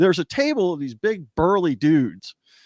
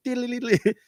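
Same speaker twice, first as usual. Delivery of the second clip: much higher, mouth-made guitar riff; guitar flourish imitation; 10 semitones higher than usual. Guitarist mimicking his solo in a dancer's face. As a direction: sing out a playful guitar lick. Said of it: "and I'm going".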